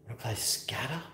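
soft teasing whisper. Real, not acted. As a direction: hush it like a secret. Whispered tease to the dog.

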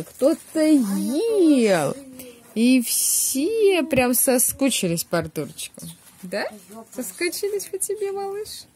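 slow, sing-song cooing. Real, not acted. Cooing to baby Artur.

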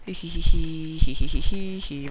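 loud, put-on "hee hee" cackle; silly giggling chant. Player fleeing after a yeet, silly giggling chant. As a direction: rattle out a silly string of hee-hees. Silly noise while running off.

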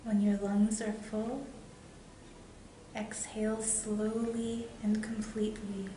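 guided meditation. Real, not acted. Meditation leader guiding viewers toward love and light, guided meditation. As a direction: guide them inward with long pauses between phrases.

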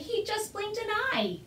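put-on high, slow, wondering boy's voice; crying out. A narrator's reading. Boy reporting the dragon's blink, crying out high. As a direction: stretch it out high with excitement. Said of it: "cried the boy".

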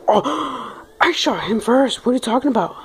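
high, outraged gamer yelp; raging gamer. Real, not acted. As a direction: screech in disbelief at the kill. Outburst after unfair kill.

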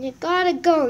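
you gotta go, grossed out; disgusted by smell. Real, not acted. Disgusted telling it off.

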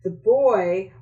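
usual speaking voice

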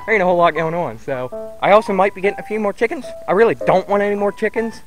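ordinary talk, nothing marked

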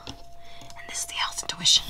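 soft, voiceless whisper. Real, not acted. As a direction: drop into a whisper for the intro. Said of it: "I don't know why I'm whispering".

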